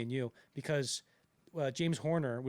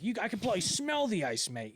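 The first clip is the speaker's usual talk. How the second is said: higher-pitched mock sailor voice; imitated ship's lookout; 6 semitones higher than usual. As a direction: play a chatty lookout, lightly hammed up. Acts out crow's nest lookout.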